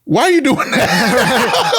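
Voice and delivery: high, slow, laughing mock question; laughing disbelief. Friend asks about crying in parking lot, laughing. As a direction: drag it out, laughing at the absurdity. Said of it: "he like".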